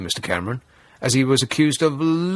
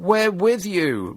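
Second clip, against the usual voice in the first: sycophant; 5 semitones higher, much slower than usual. Retold or imitated. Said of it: "one man shouted in support".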